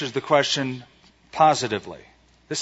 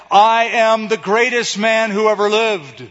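proud boast; 7 semitones higher, 5 dB louder than usual. Retold or imitated. Imagined boastful claim.

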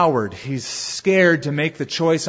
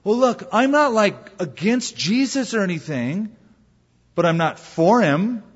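casual fence-sitter; 6 semitones higher than usual. Retold or imitated. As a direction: play the shrugging skeptic making an excuse. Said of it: "They may rationalize something like this".

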